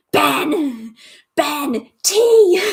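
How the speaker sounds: calling out, rising to a loud high yell on tea; mock-summon your husband from the next room; hammed-up imitation of a YouTuber calling for tea; imitating Simply Nailogical summoning Ben. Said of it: "she just summons Ben for tea".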